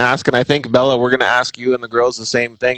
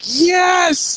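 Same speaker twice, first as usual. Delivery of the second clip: excited yes. Retold or imitated. Excited at race results sheet.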